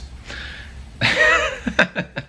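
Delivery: high burst of laughter; laughing. Real, not acted. Laughs at joke entry.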